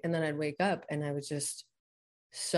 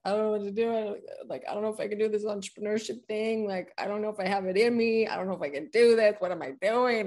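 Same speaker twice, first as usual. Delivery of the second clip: higher, hurried voice of self-doubt; a mildly performed frantic self-talk; anxious inner voice; 5 semitones higher, 4 dB louder than usual. Retold or imitated. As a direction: replay the panicked inner monologue. Inner despair retold on mat.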